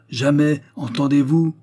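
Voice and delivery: marteler le refus, haut et fort; refus violent. A narrator's reading. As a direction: exiger d'être entendue, ton qui remonte. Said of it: "s'écria-t-elle avec violence". (refus violent)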